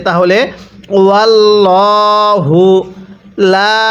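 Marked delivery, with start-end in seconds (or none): 1.0-2.6 ওয়াল্লাহু slowly, the lam of আল্লাহ thick and full; heavy lam. Shown as a demonstration. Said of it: "আল্লাহ শব্দের পূর্বে জবর আছে".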